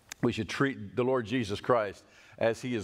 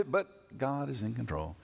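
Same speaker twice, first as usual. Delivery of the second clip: softer, tossed-off throwaway line; tacked-on afterthought; 3 semitones lower, 5 dB quieter than usual. Shown as a demonstration. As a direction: let it fall away as an afterthought. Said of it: "like it's a little afterthought".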